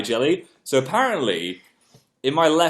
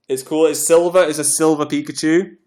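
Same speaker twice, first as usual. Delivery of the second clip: excited show-off; 5 semitones higher, 5 dB louder than usual. Real, not acted. Showing off t-shirt.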